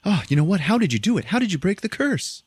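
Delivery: high, eager burst of questions, climbing; excited father. Retold or imitated. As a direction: fire the questions in rising amazement. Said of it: "and he's like".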